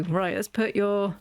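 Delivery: ordinary delivery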